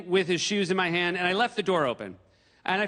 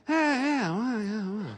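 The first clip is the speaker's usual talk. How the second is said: mock president; 5 semitones higher, slower than usual. Imitating the president handing gift.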